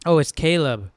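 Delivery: normal talking voice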